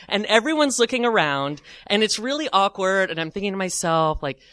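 ordinary delivery

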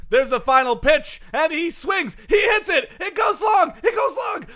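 rising shouted play-by-play; sports announcing. Acted.

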